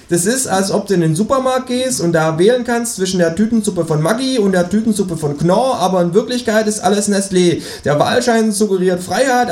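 high, cheeky kangaroo voice. Acted. To the narrator at dinner, high cheeky kangaroo voice. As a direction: pipe up as the smug kangaroo.

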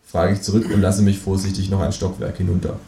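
plain reading voice

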